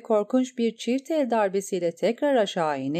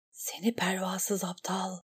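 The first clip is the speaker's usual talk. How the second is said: much softer, breathy inner whisper; whispered thought; 9 dB quieter than usual. A narrator's reading. Eriyen thinks of the reckless knight, whispered. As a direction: hiss the thought under her breath. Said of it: "diye düşünecek kadar".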